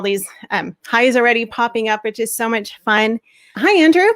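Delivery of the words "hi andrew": hi. Real, not acted. Welcoming guest on live stream.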